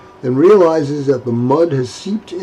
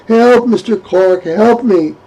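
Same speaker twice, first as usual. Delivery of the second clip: high, loud call to Mister Clark; shouting for help; 8 semitones higher, 4 dB louder than usual. Acted. Abigail shouts to Mister Clark for help. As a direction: shout to the neighbour for rescue. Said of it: "Abigail shouting".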